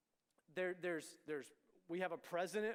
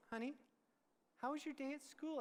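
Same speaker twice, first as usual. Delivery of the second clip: imitating gentle mom; 8 semitones higher than usual. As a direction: ask tenderly, as mom to a sulking child. Mom gently asks the sullen son.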